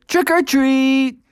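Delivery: put-on high, slow kid voice; kid trick-or-treater. A narrator's reading. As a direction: call it out like a little kid. Kids at tenth house.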